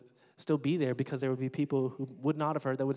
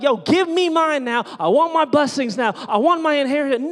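mock whiny demand, higher and louder; entitled demand; 11 semitones higher, 13 dB louder than usual. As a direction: play a greedy kid shouting for his share. Mocking entitled demanding believer.

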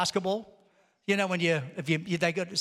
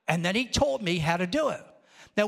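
ordinary delivery

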